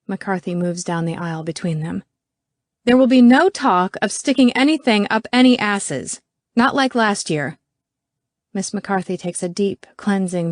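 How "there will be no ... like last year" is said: higher, louder ruling; stern scolding. A narrator's reading. McCarthy forbids the two to keep squabbling, scolding. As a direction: lay down the law to the room.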